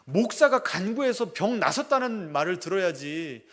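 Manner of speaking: a pastor begging God for his mother, loud and desperate; desperate prayer. Retold or imitated. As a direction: stake your life on this plea, pushing harder as you go. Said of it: "목숨을 걸고 기도했답니다".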